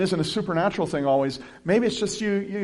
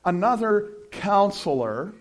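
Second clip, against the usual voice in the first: "another counselor" slow and weighty, louder; stress on another counselor; 5 semitones higher, 4 dB louder, slower than usual. Shown as a demonstration. Pastor marking an important word for listeners, slow stress. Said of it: "that's an important word".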